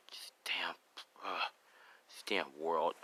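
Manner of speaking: low, half-whispered disgust; quietly pissed. Acted. Hidden figure hears the boy's story, quietly pissed. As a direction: curse under your breath, low and weary. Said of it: "he's kinda pissed".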